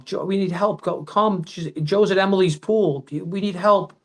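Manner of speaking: half-hearted retold shouting; panicked call for help.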